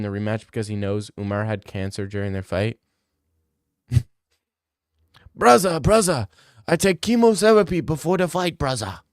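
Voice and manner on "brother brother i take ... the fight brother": high, loud mock-Dagestani accent; terrible lisping Dagestani accent; bad Dagestani accent. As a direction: put on a Dagestani accent with a speech impediment. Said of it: "like, a Dagestani with, like, a speech impediment".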